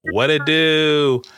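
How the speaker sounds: high, slow drawled greeting; Joey impression. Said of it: "that's my Joey impression".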